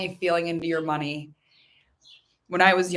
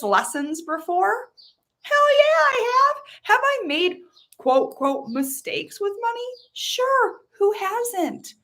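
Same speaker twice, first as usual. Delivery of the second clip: much higher, energetic, rhetorical questions answered with a whoop; excited; 11 semitones higher than usual. Real, not acted. Coach celebrating her own spending, high and exuberant. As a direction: celebrate loving money. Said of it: "exuberant about money, hell yeah".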